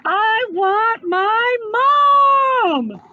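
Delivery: high, slow crying shout; a frightened boy cries out for his mom; tearful shout. A narrator's reading. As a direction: wail for mom.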